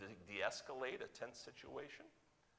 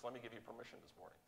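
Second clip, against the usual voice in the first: usual speaking voice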